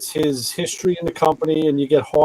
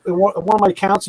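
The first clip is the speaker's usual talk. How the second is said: high, hammed-up interrogation; retold dealer quizzing a walk-on, mock suspicious; mock suspicious grilling; 7 semitones higher than usual. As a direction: needle the stranger with questions. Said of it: "He goes".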